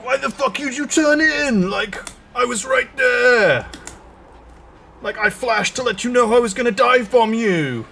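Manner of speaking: high, loud ranting racer; angry. Retold or imitated. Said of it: "And then was like".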